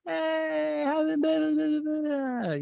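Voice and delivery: high, long-drawn slurry hello; tipsy greeting. Retold or imitated. Host imitating a tipsy late arrival greeting everyone, tipsy. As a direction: imitate a tipsy party guest squealing hello. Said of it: "she seems tipsy".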